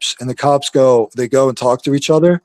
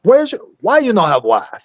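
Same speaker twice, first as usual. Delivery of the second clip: higher, joking put-on foreign voice; raised-pitch silly broken-English voice; put-on accent; 7 semitones higher than usual. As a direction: goof around in a mock accent.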